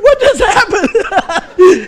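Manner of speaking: put-on high, loud, drawn-out bewildered kid; Thomas. Son baffled by his crying dad, mock bewilderment. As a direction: imitate a baffled child. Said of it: "He's like".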